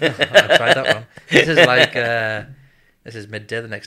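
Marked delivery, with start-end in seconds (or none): 0.0-2.4 laughing out loud mid-sentence. Real, not acted. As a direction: break into a loud laugh, slowing. Laughs after the listener's joke.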